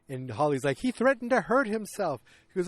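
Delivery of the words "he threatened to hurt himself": high-pitched mock defensiveness; defensive excuse. Holly excusing why she told, defensive. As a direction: blurt it up high, justifying yourself.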